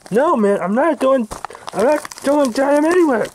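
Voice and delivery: high, protesting wail; frantic refusal. Acted. Man being dragged out, refusing frantically.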